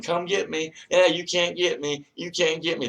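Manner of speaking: high, loud teasing voice daring them to come; mocking taunt. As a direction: taunt like a bratty kid who can't be caught. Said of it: "mocking us, saying".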